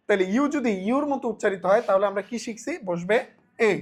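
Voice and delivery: ordinary talk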